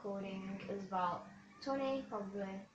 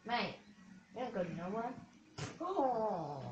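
normal talking voice

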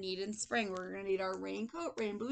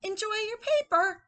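high, loud sing-song; cheery storybook character; 11 semitones higher, 7 dB louder than usual. Acted. Teacher voices newspaper greeting, high and cheery. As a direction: chirp a friendly send-off.

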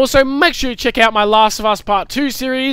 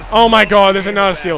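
ordinary delivery

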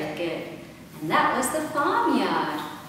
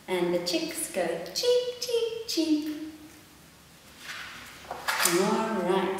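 usual speaking voice

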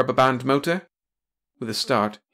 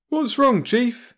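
breezy, much higher question; jaunty; 14 semitones higher, 5 dB louder, much slower than usual. A narrator's reading. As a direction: toss it off cheerfully to the boss. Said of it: "asked Brad jauntily".

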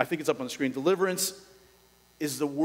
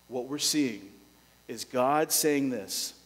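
ordinary talk

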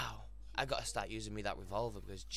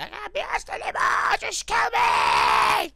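high, loud drawn-out giving-up wail; hammed-up cry of surrender; imitating a player who gave up; mock despair; 19 dB louder, slower than usual. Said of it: "You're like".

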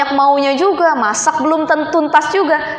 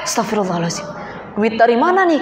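hushed, startled Astagfirullah, lower and softer; mock startled gasp; 4 semitones lower, 5 dB quieter, faster than usual. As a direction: play finding surprise money, mock-shocked and hushed. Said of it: "kita langsung kaget". (kaget pura-pura, pelan dan rendah)

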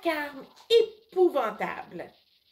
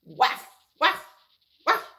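louder yapping woof sounds; neighbour's dog yapping loud; dog barking imitation; 3 semitones higher, 6 dB louder than usual. A narrator's reading. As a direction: bark like a little dog. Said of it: "japper encore plus fort".

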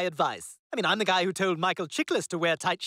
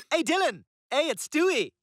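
higher, eager hello on the phone; chirpy phone greeting; 6 semitones higher than usual. Acted. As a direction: greet him with put-on cheer. Brightly takes over the phone call.

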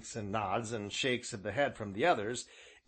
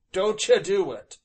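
firm, louder warning; 8 semitones higher, 9 dB louder than usual. A narrator's reading. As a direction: insist he mustn't, loudly. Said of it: "said Job warningly".

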